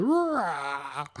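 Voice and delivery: drawn-out mock roar; long, rough "raaah" animal cry; giraffe roar. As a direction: roar playfully like a cartoon giraffe. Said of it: "the giraffe goes".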